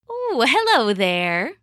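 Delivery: slow, drawn-out fox greeting; sly fox. Acted. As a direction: draw it out like a sly storybook fox. Sly fox greets his prey.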